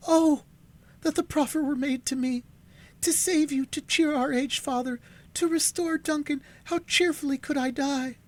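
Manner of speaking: put-on high, loud, fervent girlish voice; young sister's plea. A narrator's reading. Alice wishing she could die for her family, high and fervent. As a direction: cry it out high, longing to sacrifice herself.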